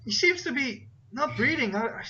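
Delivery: put-on high-pitched pleading kid; high, halting child voice; boy's voice. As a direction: plead urgently as a young boy. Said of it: "the boy's like".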